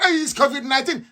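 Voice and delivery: high, breezy mock excuse. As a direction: toss it off like a cheap alibi. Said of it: "they said".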